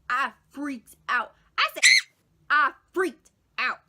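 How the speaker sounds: loud, slow, hammed-up panic; freaking out. Telling how bath water set off panic, loud dramatic alarm. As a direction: punch each word out in mock terror.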